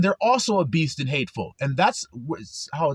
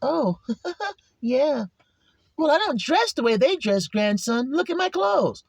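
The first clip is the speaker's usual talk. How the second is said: proud elder; 8 semitones higher than usual. Acted. Voicing a set-apart elder.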